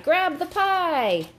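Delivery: high, drawn-out call to action; urgent cry. A narrator's reading. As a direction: cry it like a getaway signal. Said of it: "he cried".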